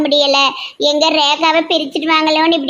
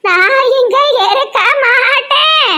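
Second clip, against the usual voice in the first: hammed-up wail; 8 semitones higher, 3 dB louder, slower than usual. Fleeing aunt hams up protest.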